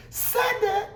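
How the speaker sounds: very high squealed mock shout; hyper yell. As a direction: shriek it like an overexcited hype man. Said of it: "'cause I act hyper".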